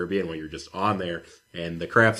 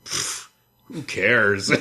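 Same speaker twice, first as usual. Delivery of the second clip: slow, dismissive mock voice; 4 dB louder, much slower than usual. Mocking an uncaring mother, slow dismissive scoff. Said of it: "And she's like".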